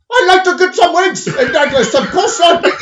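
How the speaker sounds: put-on high, loud botched Scottish accent; mock Scottish accent gone off course; bad Scottish accent. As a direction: badly fake a Scottish customer ordering, loudly. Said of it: "that's not Scottish".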